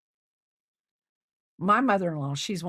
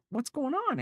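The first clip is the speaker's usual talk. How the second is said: pitched way up, surprised and worried; a caring, high "what's going on"; concerned question; 12 semitones higher than usual. Retold or imitated. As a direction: ask it high and startled. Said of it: "I was like".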